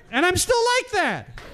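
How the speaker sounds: high-pitched mock outburst; high strained outburst. Acts out his own exasperated exclamation.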